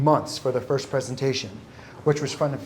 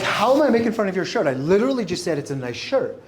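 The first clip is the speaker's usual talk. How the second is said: incredulous; 6 semitones higher than usual. Retold or imitated. Retelling his own indignant reply.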